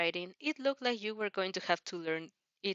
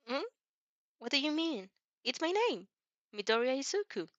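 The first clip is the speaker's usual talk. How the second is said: put-on higher, eager boy explaining his phone; bright, helpful young hero showing off; Izuku voice; 6 semitones higher than usual. Izuku confused by question.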